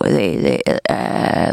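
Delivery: low creaky croak in the throat; vocal fry croak. Shown as a demonstration. Explaining vocal fry, demos a slow low croak. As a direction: rattle it out like a frog, slow. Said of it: "a frog intonation".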